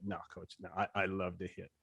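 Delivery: low, very soft, deferential answer; quiet reply. Retold or imitated. Young recruit answering a grilling coach, quiet and low. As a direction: answer the coach low and under your breath.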